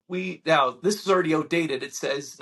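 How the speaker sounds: ordinary delivery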